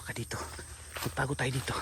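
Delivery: hushed whisper, hiding; whispering while hiding. Real, not acted. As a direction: whisper so they won't hear. Hiding from approaching people.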